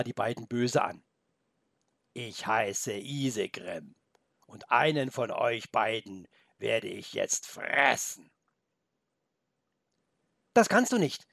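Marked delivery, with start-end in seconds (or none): 2.1-8.3 slow, low wolf voice; menacing wolf. Acted. The wolf Isegrim threatens the boy and goat, low and slow. 10.5-11.3 put-on high, loud frightened boy; horrified cry. The boy cries out at the wolf, high and horrified. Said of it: "rief Felix entsetzt".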